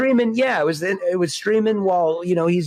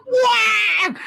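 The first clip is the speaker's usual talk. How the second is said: startled yell. Retold or imitated. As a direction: yelp it as if something flies at you. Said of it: "you're like".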